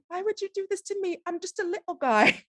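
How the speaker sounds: high, pitiful mock pleading; squeaky put-on plea breaking into laughs; little-guy voice. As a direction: play a tiny, helpless victim. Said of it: "My favorite part of that is like".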